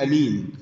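أمين with a short, clipped opening vowel; short first vowel. Shown as a demonstration. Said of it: "هذا خطأ". (أمين بهمزة قصيرة بلا مد)

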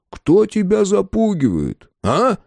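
high, forceful challenge; sharp questioning. A narrator's reading. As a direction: throw the question at him sharply. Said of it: "резко спросил Андрей".

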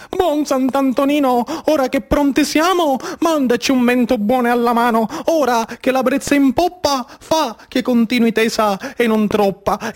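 prayer said aloud in a fuller voice; spoken prayer. Acted. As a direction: pray openly to Sant'Antonino. Prayer said again as the child. (preghiera detta a voce piena)

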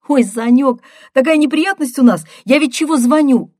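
high, loud, hurried phone voice; agitated sister. A narrator's reading. Sister phoning brother about some trouble, loud and agitated.

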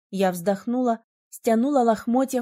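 usual speaking voice

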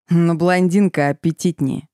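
ordinary delivery, nothing marked

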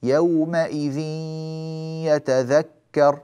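يومئذ يتذكر with the nasal hum of the tanween flowing into the ya, drawn out slow; nasalized idgham demo. Shown as a demonstration. Teacher chanting يومئذ يتذكر slowly for students. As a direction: stretch يتذكر with a long ghunna. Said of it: "لاحظوا أن الغنة تدخل في الياء".

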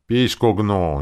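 nervy retort. A narrator's reading. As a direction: snap it back nervily. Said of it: "нервно отозвалась служащая мэрии".

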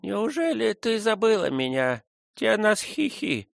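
put-on high, slow old Indian woman; old woman mumbling. A narrator's reading. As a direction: mumble it like a toothless old nurse. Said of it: "пробормотала та".

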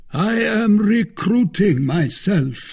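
high, slow, stretched-out declaration; self-satisfied Porthos. A narrator's reading. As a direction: announce it grandly, pitched high and slow. Porthos explains his lavish dinner.

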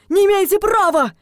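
loud, high-pitched protest; frightened, indignant cry; gasped alarm. A narrator's reading. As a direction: protest in rising panic. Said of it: "ахнул он".

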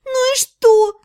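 high, crying cry of protest; a sister shouting through tears; tearful shout. A narrator's reading. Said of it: "со слезами на глазах закричала".

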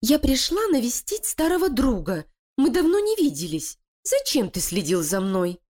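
a high, sweetly coaxing wife's voice; falsely gentle. Acted. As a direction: coax the rat with fake tenderness. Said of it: "обратилась к крысе ласковым голосом". (Лилия ласково обращается к крысе, притворно нежно)

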